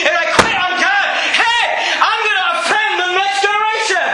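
full-voiced pulpit exhortation; impassioned preaching. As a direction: press the congregation hard, pitch climbing to the last line. Preacher builds to a forceful appeal.

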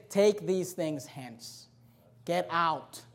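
low, soft and very slow; a calm, hushed order to leave; quiet firm warning. Pretend. Pastor acting out church staff telling a seller, quiet and firm.

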